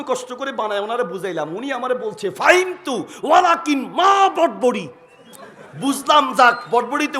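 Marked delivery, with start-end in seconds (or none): 2.3-4.9 high, loud, slow puzzled question; puzzled Saudi speaker. Retold or imitated. Saudi speaker asking the translator, high and baffled. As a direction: drag out the confused question about "বটবড়ি" high and loud.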